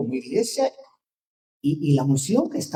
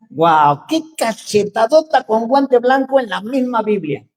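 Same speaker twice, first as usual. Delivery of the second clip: ordinary talk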